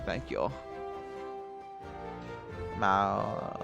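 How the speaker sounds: ordinary talk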